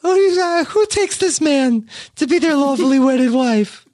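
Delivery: put-on officiate a wedding in baby talk. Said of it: "vows in the baby talk voice".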